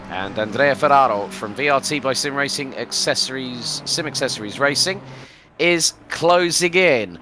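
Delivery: energetic sim racing commentator; live play-by-play. Real, not acted. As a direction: call the race to the listeners, stretching key phrases. Live race commentary resumes.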